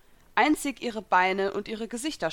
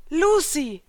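half glad, half furious shout; Natsu; 8 semitones higher, 7 dB louder than usual. A narrator's reading. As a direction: call out loud and high, between joy and rage. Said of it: "rief Natsu halb erfreut, halb rasend".

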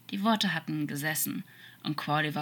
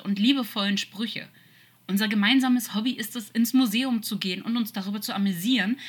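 raised, insistent listing; loud, higher impassioned appeal; urgent pleading; 5 semitones higher, 5 dB louder than usual. Acted. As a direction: pile up the memories to get through to him. Demon pleads with memories mid-fight.

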